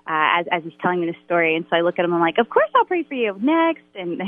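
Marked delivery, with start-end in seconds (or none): 2.4-3.8 breezy brush-off. Retold or imitated. Brushing off a fan after a show.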